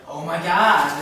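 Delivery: loud exclamation, well above talking volume; excited gossip. Acted. Girl echoing her friend in gossip, excited.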